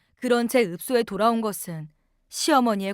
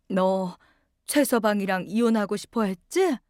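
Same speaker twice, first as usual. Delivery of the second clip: weak sick mother. Acted. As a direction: speak gently, barely awake. Sick mother speaks to daughter. (혼수에서 깨어난 엄마, 느리고 힘없는 다정한 목소리)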